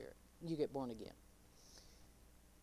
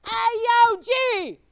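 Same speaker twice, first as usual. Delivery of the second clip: street greeting to God; 12 semitones higher, 14 dB louder, slower than usual. Retold or imitated. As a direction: yell it like greeting a buddy. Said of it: "I've heard of the".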